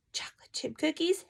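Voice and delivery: high breathless whisper; amazed whisper at the cookie offer; awed whisper. Retold or imitated. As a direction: whisper it like treasure.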